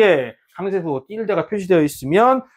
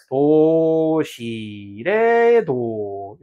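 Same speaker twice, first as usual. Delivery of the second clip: 도 시 레 도 sung up and down as notes; pitch demo; much slower than usual. Shown as a demonstration. Teacher showing students stress as pitch with notes. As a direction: show the pitch steps on 도 시 레 도. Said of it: "음정의 높낮이로 구분하시는".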